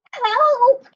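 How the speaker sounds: very high, loud shout for help; cry for help. Acted. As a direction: scream down from above. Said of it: "came a cry from above".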